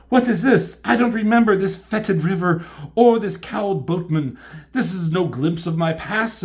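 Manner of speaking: high, loud, overwrought stage voice; melodramatic heroine, horrified. Acted. Elektra facing a vision of the river, hammed-up horror. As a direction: declaim it in mock horror.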